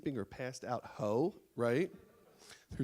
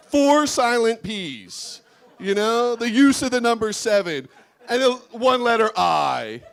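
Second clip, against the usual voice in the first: mock outrage; 5 semitones higher, 11 dB louder than usual.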